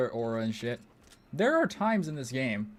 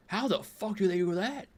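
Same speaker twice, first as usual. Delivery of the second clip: higher, incredulous question; baffled question; 6 semitones higher than usual. Retold or imitated. Streamer wondering how the bots do that, incredulous. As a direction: ask it like you can't believe it.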